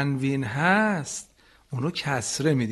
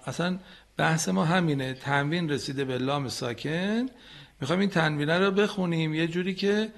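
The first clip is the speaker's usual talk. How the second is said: ordinary talk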